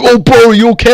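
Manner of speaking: imitating the kid. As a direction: play the careless young coworker asking if he's okay. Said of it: "he's like".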